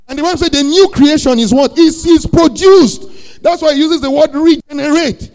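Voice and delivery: loud, high, rising sermon cry; full-force preaching. Acted. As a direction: preach it out to the whole church.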